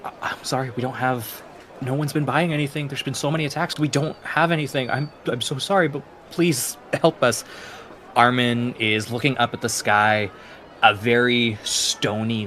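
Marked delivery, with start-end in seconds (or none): 0.0-7.4 soft, quiet begging mother; a touch of forlorn mother; forlorn pleading. Acted. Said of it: "looking down very forlorn".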